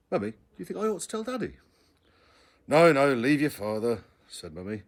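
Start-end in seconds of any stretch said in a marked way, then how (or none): 0.1-1.6 put-on much higher, fast little boy's voice; a boy bursting to tell; quick, high young boy asking; eager child. A narrator's reading. 2.7-4.0 louder, slow firm no; mother putting her foot down. A narrator's reading. Mother hushing child.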